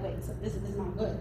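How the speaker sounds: low, hushed worry; mock fright. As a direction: mutter it uneasily under your breath. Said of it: "felt the fright as well".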